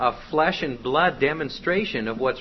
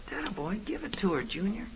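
higher, hushed gangster egging-on; gangster demon boss; 5 semitones higher, 7 dB quieter, faster than usual. Acted. Boss cheers Junior on, gangster-style. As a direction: urge on the rookie under your breath. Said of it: "act like gangsters".

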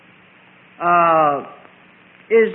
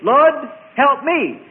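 pleading cry, louder and higher; selfish prayer; 6 semitones higher, 6 dB louder, much slower than usual. Shown as a demonstration. Preacher acts out a self-centred prayer, pleading loudly. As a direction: call out the selfish plea. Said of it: "instead of".

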